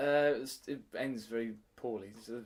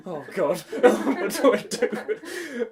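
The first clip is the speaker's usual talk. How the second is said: slow, laughing mock panic, louder and higher; 9 semitones higher, 9 dB louder, much slower than usual. Teacher retelling her own panic, laughing and slow. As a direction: laugh through a panicked question.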